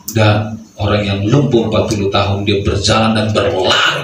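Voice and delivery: usual speaking voice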